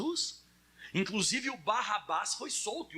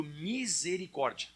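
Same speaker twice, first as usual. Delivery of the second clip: drawn-out slow misericórdia; slow repeat-after-me; 2 semitones lower, much slower than usual. Shown as a demonstration. Pastor leading the church to repeat the word, drawn out slowly. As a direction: stretch misericórdia out slowly, syllable by syllable. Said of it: "Fala comigo".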